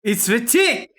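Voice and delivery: loud, high recognition; excited shout. Real, not acted. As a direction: shout it out in delight.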